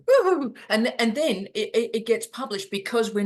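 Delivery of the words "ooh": high, loud squealing ooh; over-the-top fake admiration; mock impressed ooh. Mocking an AI peer reviewer, a high loud delighted ooh. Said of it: "and then they go".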